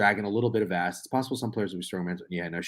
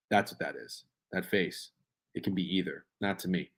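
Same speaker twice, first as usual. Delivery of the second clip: normal talking voice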